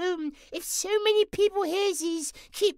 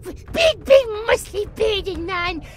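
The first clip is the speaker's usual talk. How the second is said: awestruck excitement; 2 semitones higher, 6 dB louder, slower than usual. Acted. J J greeting a big bearded man, loud childlike awe. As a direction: gush like a thrilled little kid, drawing words out.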